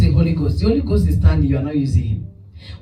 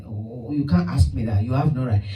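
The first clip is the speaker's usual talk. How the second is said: a hint of a cornered husband, lower and softer; flustered husband; 7 semitones lower, 5 dB quieter than usual. Husband dodging the pastor's question, lightly voiced and defensive. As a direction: duck the question like a guilty man.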